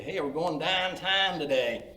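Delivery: Pittsburgh accent on "downtown". Shown as a demonstration. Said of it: "Pittsburgh pronunciation of words".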